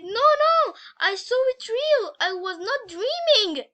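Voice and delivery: high, loud insistent cry; vehement protest. A narrator's reading. Scared sister to her doubting brother, vehement protest. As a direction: protest at full voice, desperate to be believed. Girl protests she was not dreaming.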